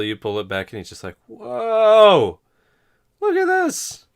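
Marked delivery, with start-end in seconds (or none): 1.3-4.1 high, loud astonished shout; amazed boy. Acted. Pimply boy marvelling at the stretched wing, yelling. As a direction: gasp and yell like a wide-eyed kid.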